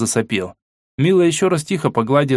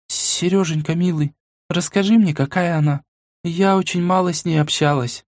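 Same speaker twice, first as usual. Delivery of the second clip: high, tender coaxing; tender girl voice; 6 semitones higher than usual. A narrator's reading. Tender Mila. (нежный девичий голос)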